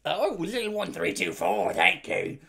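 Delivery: hammed-up goblin Cockney voice; goblin Cockney accent. Streamer thanking a viewer for a posture check, goblin Cockney accent. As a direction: answer as a terrible Cockney goblin. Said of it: "answer that posture check in that voice".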